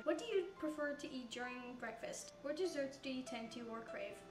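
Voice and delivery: ordinary talk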